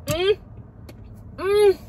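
high, loud delighted mmm; delighted hum. Real, not acted. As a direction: hum with pleasure at the taste. Tasting a favorite cookie.

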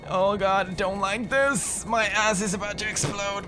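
high-pitched, stretched-out panic; high, anxious whine; panicked dread. Real, not acted. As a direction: wail it as the fear takes over. Frightened by the game.

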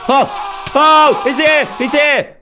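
high, loud panicked yell; shrieking in real fright at a jump scare; terrified yelling. Real, not acted. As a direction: scream it as the monster appears.

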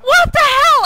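loud, high exclamation; startled outcry. Real, not acted. Player clipping through the ground, startled outcry. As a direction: cry out in alarmed disbelief. Sudden mishap in the game.

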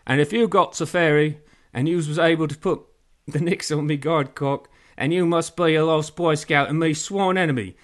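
loud pirate with a mouth full of muck; garbled, loud pirate voice. A narrator's reading. Pirate scolding his sworn enemy, loud mouth-full voice. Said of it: "sounded like he had shit in his mouth".

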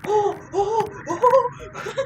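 sharp gasp into high, loud cries; real fright at a jumpscare; gasping shriek of fright; startled scream. Real, not acted.

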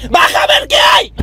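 high, loud shouted order; yelling downstairs. Acted. Mother shouting to Juan Pablo to go downstairs. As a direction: call him down at full voice.

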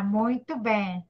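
ordinary talk

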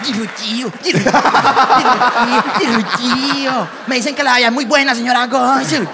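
agudo y fuerte, lento, riendo; grito eufórico. Retold or imitated. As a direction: grita con euforia desbordada. Amigo gritando ante la cónsul. (amigo eufórico gritándole a la cónsul)

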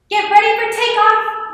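high, loud pilot's shout; high-pitched, ringing announcement. A narrator's reading. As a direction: shout the takeoff warning to everyone aboard. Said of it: "Leonardo shouted".

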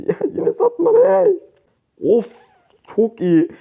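high squeal of laughter through the words; laughing. Real, not acted. Teammate misplays, laughs. (gülerek, tiz sesle)

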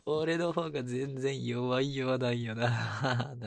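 laughing. Real, not acted. Laughing at his own weakness.

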